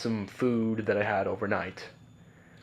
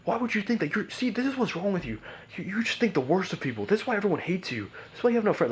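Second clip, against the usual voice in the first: high-pitched furious rant quoting dad; mock the angry father, pitch way up; 8 semitones higher than usual. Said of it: "He got so mad".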